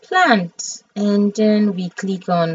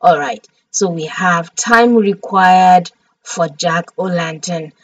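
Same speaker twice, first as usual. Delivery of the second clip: ordinary talk, nothing marked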